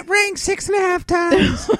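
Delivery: high, drawn-out mock whine; upset caller. Podcaster mimicking callers upset at unanswered phones, mock upset. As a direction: imitate an outraged caller, squealing it slowly. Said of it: "all upset".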